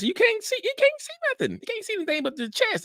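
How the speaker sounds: very high falsetto insistence; squeaky exclamation. Acted. Speaker insisting the heart in the chest is blind, squeaky. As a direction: jump way up into a squeak.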